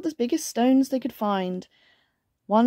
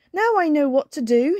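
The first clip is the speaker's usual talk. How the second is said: high, loud storybook exclamation; crying out in excitement; 7 semitones higher, 6 dB louder than usual. A narrator's reading. Bear spots the answer, excitedly crying out. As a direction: call it out with sudden excitement. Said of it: "he cried".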